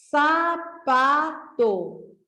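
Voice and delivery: sapato drawn out slowly as one word; teacher slowly joining the syllables into the word; slow blended word. Shown as a demonstration. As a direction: stretch sapato out to join the syllables. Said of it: "Para formar essa palavra sapato".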